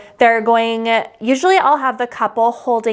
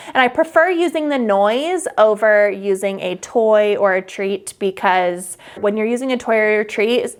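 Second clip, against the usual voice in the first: ordinary delivery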